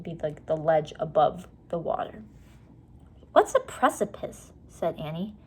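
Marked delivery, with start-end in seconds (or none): 3.3-4.5 put-on high curious young girl; little girl voice. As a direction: ask it as a small girl.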